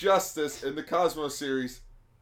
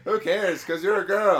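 higher, louder cartoon taunt; mock shouted joke; 7 semitones higher, 7 dB louder than usual. Friend supplies the remembered joke, loud mock taunt. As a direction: call out the punchline.

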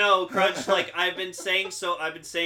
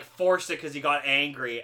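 normal talking voice

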